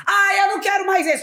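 high, loud whiny refusal; imitating Narcisa. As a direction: sulk loudly. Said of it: "aí soltou ela". (recusa aguda e birrenta imitando a Narcisa)

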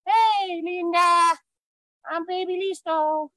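put-on high, childlike puppet voice; squeaky little baby voice; baby gorilla voice. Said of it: "This is Listo".

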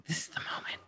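breathless whispered anticipation. Retold or imitated. Retelling Sylvie waiting for a confession, excited whisper. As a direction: whisper it as the big moment arrives. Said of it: "and she's like".

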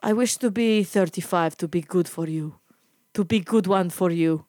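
accented old suitor impression; creepy old man. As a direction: mimic a wistful old driver's broken English. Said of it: "this creepy old man".

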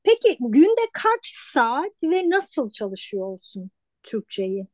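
ordinary talk, nothing marked

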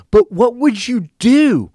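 high, loud and slow demand; mock scream. Girl screaming her question at the cousin again, slow loud mock scream. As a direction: drag out each word like she's screaming it. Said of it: "she starts going insane".

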